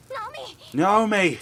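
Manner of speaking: a very high call, then a loud shout; desperate cry. Acted. Rinka crying out for Naomi through the fire, frantic and loud. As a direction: scream her friend's name in panic. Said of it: "Even Rinka's cries are overwhelmed".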